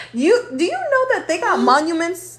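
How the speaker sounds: high-pitched acted astonishment; mock-stunned questioning; incredulous. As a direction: press them in amazed disbelief, swooping upward. Acts out disbelieving admirer of Jesus.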